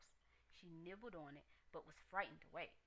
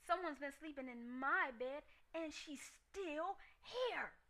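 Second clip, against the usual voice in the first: much higher, louder little bear wail; Baby Bear screaming; 8 semitones higher, 5 dB louder, slower than usual. A narrator's reading. Baby Bear finds his porridge gone, high tearful scream. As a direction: scream it like an upset cub. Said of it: "screamed Baby Bear".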